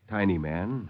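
ordinary delivery, nothing marked

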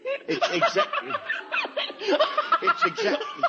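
high laughing squeal on the words; can't get the sentence out for laughing. Acted. Dad keeps breaking into laughter.

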